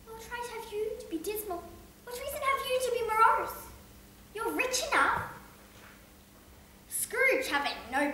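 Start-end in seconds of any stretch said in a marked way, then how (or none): 0.1-5.2 gaily. Acted. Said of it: "returned the nephew gaily".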